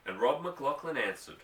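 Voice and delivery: plain reading voice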